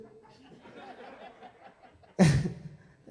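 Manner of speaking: loud, high burst of laughter; laughing at his own punchline; hearty laugh. Real, not acted. Teller laughs at his own story, hearty.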